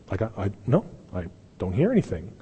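low, soft, halting denial; mock-oblivious murmur; feigned obliviousness. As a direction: play innocent, as if hearing nothing. Acts Jesus pretending not to hear.

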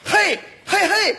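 high barked army cheers; shouting slogans. Acted. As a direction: shout hey like a marching squad. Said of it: "你喊口号".

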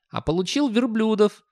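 very high youth voice; skinny youngster. Acted. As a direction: answer back in a high young voice. Youngster answers boss.

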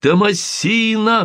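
high, slow calling out; crying out. Acted. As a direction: cry the name out in joy. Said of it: "вскричали разом".

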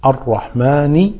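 slow الرحمن ending in "-ni"; kasra on nun. Shown as a demonstration. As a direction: draw out الرحمن with a final rising i. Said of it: "بكسر النون".

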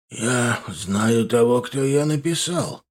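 put-on slow, frail old man voice; old grandfather. A narrator's reading. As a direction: speak like a weary old grandfather. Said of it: "ответил дедушка языком людей". (медленный голос старого деда)

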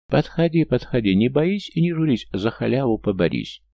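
high, loud hawker patter; loud carnival barker. Acted. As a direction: call the crowd in, full voice. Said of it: "сильным акцентом вещал Шулер".

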